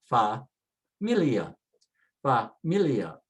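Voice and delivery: familia, broken up slowly with MI stressed; stress next to last. Shown as a demonstration. Teacher demonstrates the rule, slowly stressing familia on MI. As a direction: say familia slowly, leaning on the middle. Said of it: "stress next to the last and say".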